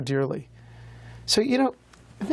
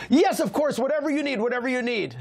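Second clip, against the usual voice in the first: eager give-in; 7 semitones higher than usual. Retold or imitated. Shivering rich man agreeing to the rabbi, high and eager. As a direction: blurt it out, desperate to get back inside.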